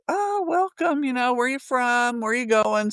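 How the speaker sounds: high, bright welcome from the shopkeeper; cheery shop lady. Retold or imitated. Shop owner welcoming quilters, high and cheery. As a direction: greet them like a delighted shop owner. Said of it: "she goes".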